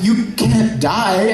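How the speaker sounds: high, slow, drawn-out anguish; acted disbelief. Flint over the collapsed girl, acted anguished disbelief. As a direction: cry out in grieving denial.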